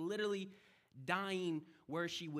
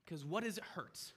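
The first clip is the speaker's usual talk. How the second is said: ordinary delivery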